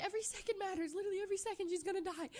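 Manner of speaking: fast, high panicked rush. Retold or imitated. Panicked in car.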